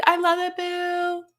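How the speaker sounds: high, slow, drawn-out sugary voice; mock sweet Jessica. Mocking Jessica's sweet reply, high and slow. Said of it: "she's like".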